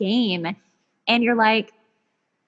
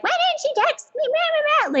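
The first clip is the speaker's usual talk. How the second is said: high nasal-free whine, much higher pitch; mock her own whiny complaint. Mocking her own whiny text to the boy. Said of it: "be like".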